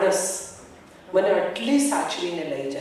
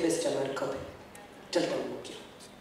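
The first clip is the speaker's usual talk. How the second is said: much softer and lower, tender; gentle Kabir; 7 semitones lower, 14 dB quieter than usual. Retold or imitated. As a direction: hush it like comforting a child. Kabir, gently lifting Lali.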